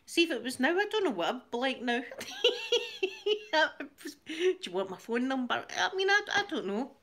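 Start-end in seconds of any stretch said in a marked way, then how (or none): 2.1-4.2 long squealing real laugh, much higher. Real, not acted. Squealing laugh at her own phone-number joke. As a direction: let the laugh run high and long.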